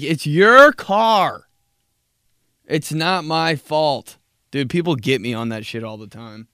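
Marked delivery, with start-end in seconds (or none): 0.0-4.2 loud, high, drawn-out objection; acted indignant outburst, slow and loud; exasperated protest. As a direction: stretch the words out loudly in protest. Acted refusal to stranger asking help.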